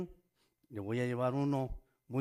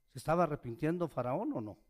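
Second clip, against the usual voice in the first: usual speaking voice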